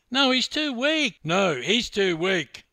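loud, high, sing-out jeer; taunting. Acted. Teammate mocking the swimmer's fitness, taunting. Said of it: "taunted Jono".

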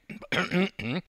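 pointed preparatory throat-clear. Acted. Laurie about to sing for the youths, throat clearing. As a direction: clear the throat before performing.

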